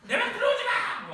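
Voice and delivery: high, loud bratty shout at mom; angry teen yell. Acted. Teenager shouting at mom to stay out, high angry yell. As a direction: yell like a kid slamming the door.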